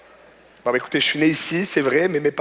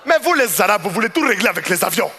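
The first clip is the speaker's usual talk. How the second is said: accusing customer; 2 semitones higher than usual. Retold or imitated. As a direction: push him around and cut the talk short.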